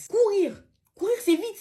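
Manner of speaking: rising outraged exclamation; indignant shout. Acted. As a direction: shout it in disbelief. Outraged at slow runners.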